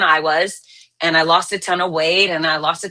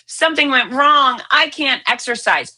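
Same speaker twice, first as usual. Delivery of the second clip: mock whiny excuse; 6 semitones higher than usual. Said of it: "the great majority of folks say".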